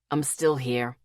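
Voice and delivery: low gruff grumble of a tired boss; husky grousing man. A narrator's reading. Dylan on the phone from work, husky and grousing. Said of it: "his husky voice groused".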